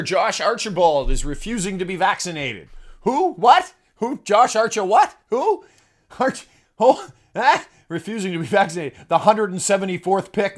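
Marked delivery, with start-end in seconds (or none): 3.0-7.7 high, loud baffled questions; baffled who. Pretend. Host hearing an unknown player's name, high baffled sputtering. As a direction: sputter in mock confusion.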